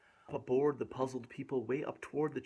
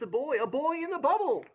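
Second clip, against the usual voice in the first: high sing-song; 10 semitones higher, 5 dB louder than usual. A narrator's reading. Dramatic reading to a child.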